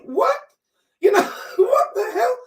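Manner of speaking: high, stunned "What?" breaking into a laugh; incredulous. Retold or imitated. Daughter hearing of a joint funeral, incredulous and laughing. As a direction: disbelief spilling into laughter. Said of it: "I'm like".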